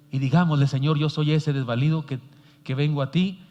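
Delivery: oración ferviente, más fuerte al inicio; guía a la congregación a orar al Señor; oración guiada. Acted. (predicador guía la oración de la congregación, con fervor)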